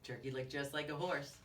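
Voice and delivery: plain reading voice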